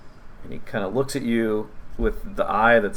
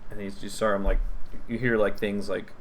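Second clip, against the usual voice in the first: usual speaking voice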